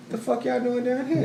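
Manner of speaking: high, mock-shocked question; amused disbelief. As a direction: raise the pitch like you can't believe it. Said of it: "And I'm like".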